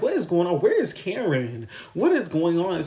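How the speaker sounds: high, mock-confused questions; bewildered. Retelling viewers confused about Karen, high mock-bewildered questions. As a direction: act out the viewers' baffled alarm. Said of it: "We're just like".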